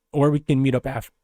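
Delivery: cracking voice. Real, not acted. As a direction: voice breaks mid-word. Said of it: "voice crack".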